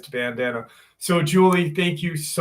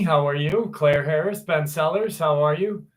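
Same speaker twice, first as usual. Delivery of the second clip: usual speaking voice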